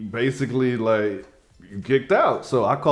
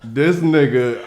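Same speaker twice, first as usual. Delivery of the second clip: ordinary talk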